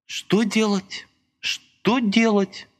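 very slow, high despairing murmur; anguished mumble. A narrator's reading. Lika despairs beside Masha, slow anguished mumble. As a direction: mutter it to yourself in anguish. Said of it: "в тоске забормотала она".

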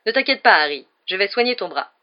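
breezy, projected reassurance; confident reassurance. A narrator's reading. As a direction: take charge in a big voice. Lockhart loudly takes charge of injured boy.

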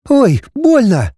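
high, drawn-out cry of pain; sudden hurt "ouch"; pained yelp. A narrator's reading. Scales hurt her suddenly.

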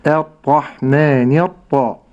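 الرحمن with a thin light opening hamza, slow; light hamza demo. Shown as a demonstration. Sheikh showing the light hamzat al-wasl, slowly. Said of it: "ترقيق همزة الوصل".

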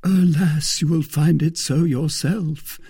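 high, plaintive "alas"; sighing lament. A narrator's reading. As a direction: lament it with a sigh in the voice. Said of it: "said Aramis with a sigh".

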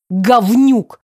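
loud one-word insult; angry bark. A narrator's reading. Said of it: "рявкнула я". (злобно рявкнуть)

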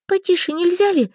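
выше, сдавленно и возмущённо; возмутись, будто тебя придавили; сдавленное возмущение. A narrator's reading. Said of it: "сдавленно возмутилась я". (сдавленно возмутиться)

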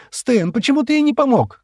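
high, loud accusing cry; shouted reproach. A narrator's reading. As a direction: cry out the question, pitch very high. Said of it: "крикнула Шира". (отчаянный крик с упрёком)

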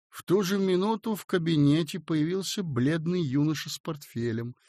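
plain reading voice

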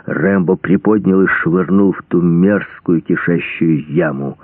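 usual speaking voice

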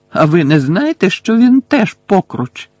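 desperate retort. Acted. Harry desperately interrupts Bellatrix, loud and high. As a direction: cut her off in desperation. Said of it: "відчайдушно урвав її Гаррі".

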